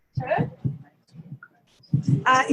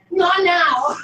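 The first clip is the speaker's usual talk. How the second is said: mock angry snap; 4 semitones higher, 6 dB louder than usual. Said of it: "But if I'm angry, I say".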